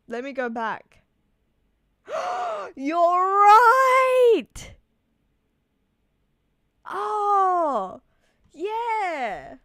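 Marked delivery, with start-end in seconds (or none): 2.1-2.7 high, sharp gasp of discovery; real startled intake of breath; surprised gasp. Real, not acted. Spots what the chat pointed out. 2.7-9.5 loud high cry, easing into drawn-out awe; real high-pitched burst of amazed agreement; excited realisation. Real, not acted. Sudden discovery after looking back.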